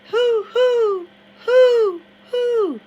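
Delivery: very high, very loud hooting like an owl; loud owl hoots. Acted. Harrison hoots across the water, very loud and high owl calls. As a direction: hoot as loud and high as you can. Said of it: "hollered again".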